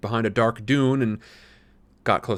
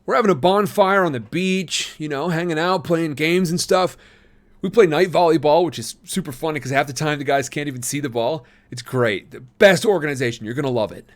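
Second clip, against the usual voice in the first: enthusiastic; 6 semitones higher, 4 dB louder than usual. A narrator's reading. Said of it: "Tom said enthusiastically".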